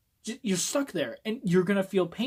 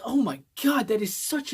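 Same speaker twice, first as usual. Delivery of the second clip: horrified whisper; 7 semitones higher, 5 dB louder than usual. Real, not acted. Horrified by the concept.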